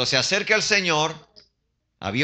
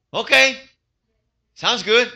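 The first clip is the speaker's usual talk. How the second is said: eager acceptance; 7 semitones higher, 5 dB louder, slower than usual. Acted. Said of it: "él me va a decir".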